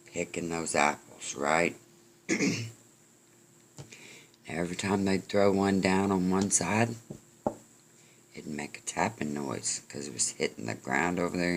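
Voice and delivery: ordinary delivery, nothing marked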